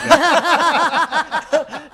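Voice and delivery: high laughing burst; burst of laughter. Real, not acted. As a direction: laugh out loud at the joke. Joke about romantic comedy.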